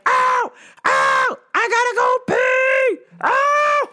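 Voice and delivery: high wailing shout, hammed up; mock yelling. Customer who has to go pee, mock yelling. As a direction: shriek like a desperate customer. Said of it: "She was yelling".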